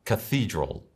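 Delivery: Cathedral with a th sound, stress on the middle; English pronunciation. Shown as a demonstration. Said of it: "¿La has dicho bien?".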